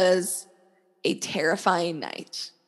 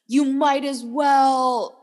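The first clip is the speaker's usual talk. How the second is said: raised, drawn-out mock shout; imitated yelling; 5 semitones higher, 6 dB louder, much slower than usual. Dude retelling starts yelling at her, mock shouting. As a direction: yell it slowly, higher and louder. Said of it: "starts yelling at me".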